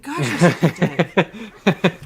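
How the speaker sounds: loud laughing jab; laughing outburst. Real, not acted. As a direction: blurt it out laughing.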